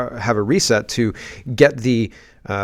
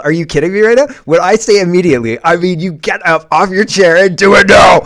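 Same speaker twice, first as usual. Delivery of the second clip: louder, higher, fed-up telling-off; exasperated parent scolding; 5 semitones higher, 7 dB louder than usual. Real, not acted.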